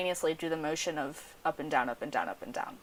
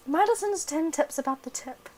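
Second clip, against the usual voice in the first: high, clipped British reading voice; British accent; 9 semitones higher than usual. Pretend. Hosts reading a friend's sex tips, British accent. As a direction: announce the title like a posh Brit. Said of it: "read this with a British accent".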